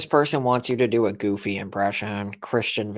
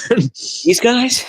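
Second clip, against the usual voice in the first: high, loud put-on questioning voice; Edward voice; 13 semitones higher, 9 dB louder than usual. Clowning as Edward for a co-host, high loud character voice. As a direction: pitch it way up and loud, like a cartoon villager. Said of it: "In Edward's voice".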